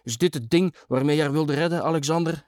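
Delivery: worked-up confrontation; calling out. A narrator's reading. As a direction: call out the accusing question. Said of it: "riep ik toen".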